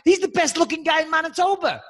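high excited teasing hype; hyped up praise. Acted. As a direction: talk him up, hyped. Mock hype about fan.

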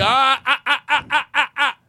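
high, loud laughing scold; mock dog-training scold, laughing. Shown as a demonstration. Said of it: "yell at yourself".

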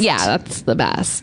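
low, slow deadpan praise; deadpan mock praise. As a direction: drag it out flatly. Mocking bland superlatives.

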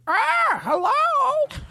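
very high squawked hello; parrot squawk. Acted.

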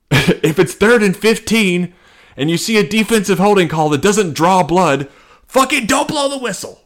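rally the refs, exploding at the end; ref character. Acted. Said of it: "I'm really into this character".

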